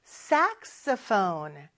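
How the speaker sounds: saxophone slow, stress on SAX then falling; saxophone pitch contour. Shown as a demonstration. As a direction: let saxophone rise and fall, SAX first. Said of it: "이렇게 높낮이가 있어요". (saxophone 높낮이 살려서)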